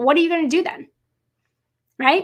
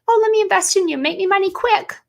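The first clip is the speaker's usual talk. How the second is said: mocking, higher and eager; mocking wrong mentality; 7 semitones higher than usual. Mocking a naive investor's wish, higher pitched. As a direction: play the naive get-rich investor. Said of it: "that's the wrong mentality".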